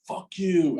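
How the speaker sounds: sharp high shouted insult; a kid yelling fuck you from a passing car; retold yell. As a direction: yell it out the car window at them. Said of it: "as loud as he possibly could".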